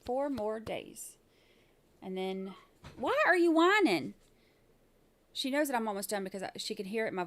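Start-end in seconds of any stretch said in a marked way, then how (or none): 3.0-4.1 calling to the dog. Real, not acted. Scolding the whining dog.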